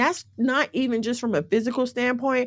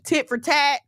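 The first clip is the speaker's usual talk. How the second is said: usual speaking voice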